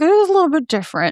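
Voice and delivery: lightly voiced sheepish doctor, dodging; mock doctor voice. Mocking the doctor's excuse.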